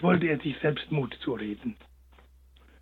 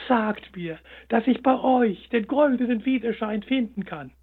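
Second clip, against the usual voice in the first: pleading hare; 6 semitones higher than usual. A narrator's reading. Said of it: "seufzte der kleine Hase".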